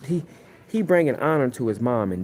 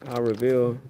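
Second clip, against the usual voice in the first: ordinary talk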